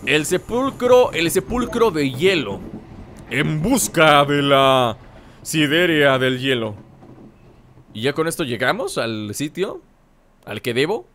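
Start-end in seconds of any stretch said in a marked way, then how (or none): 3.3-6.8 slow, low title-card voice; mock epic announcer. Gamer announcing the quest to viewers, mock epic announcer.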